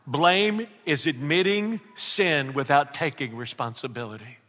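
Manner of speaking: usual speaking voice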